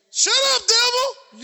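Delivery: high, loud rebuke. Acted. Preacher rebuking the devil at home, loud and fierce. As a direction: shout the devil down.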